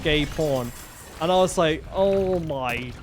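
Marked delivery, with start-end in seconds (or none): 1.9-2.9 very slow, drawn-out dismay; stunned disbelief. Retold or imitated. Phone shop worker seeing the customer's tabs, drawn-out disbelief. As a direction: stretch every word right out.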